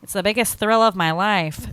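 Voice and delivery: a hint of character acting; Rizzo. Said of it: "And Rizzo goes".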